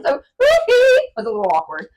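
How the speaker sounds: high, loud mock British voice; British accent. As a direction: keep the posh British bit.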